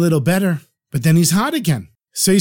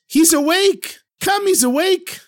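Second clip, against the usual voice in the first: much higher excited call; excited shout; 13 semitones higher than usual. Retold or imitated. Old woman calling husband upon seeing Herschel awake, high excited shout. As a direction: cry out to fetch her husband. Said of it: "she shouts".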